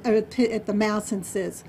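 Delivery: usual speaking voice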